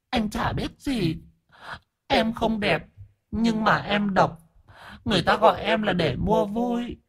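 high, soft falsetto; coy ghost hostess. Acted. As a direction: explain yourself coyly in a thin high voice. Ghost Diễm Hường speaks. (giọng ma ẻo lả, cao và khẽ)

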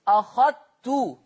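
أخذت said slowly as أخَتّ, the dhal swallowed into the ta; merged dhal. Shown as a demonstration. Teacher demonstrating the merged dhal to students. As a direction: stretch out أخذت with no dhal sound. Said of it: "مفيش بقى ذال".

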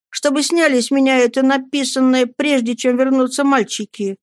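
put-on high-pitched boy's request; pleading. A narrator's reading. Pupil begging the headmaster, high-voiced. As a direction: keep the plea high and sustained.

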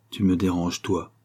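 ordinary delivery

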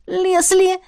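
high excited squeal of the name; delighted squeal. Acted. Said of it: "Восторженный писк Беаты". (восторженный писк)

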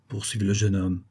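ordinary delivery, nothing marked

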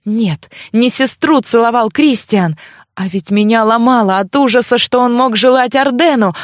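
plain reading voice

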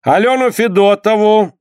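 high, official proclamation; clerk reading out. A narrator's reading. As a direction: announce the name formally. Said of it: "зачитал дьяк".

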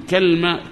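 كلمة said kalma, open ka then silent lam; kalma with sukun. Shown as a demonstration. Teacher showing students the kalma form, careful demo. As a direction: say كلمة as kal-ma with no vowel after the lam. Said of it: "فتح الكاف مع سكون الحرف الثاني".

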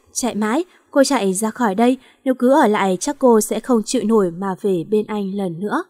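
usual speaking voice

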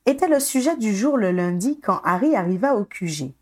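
ordinary delivery

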